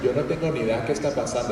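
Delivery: fast, soft Spanish rattle; rushed Spanish. Retold or imitated. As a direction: blurt it quickly. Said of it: "And I was like".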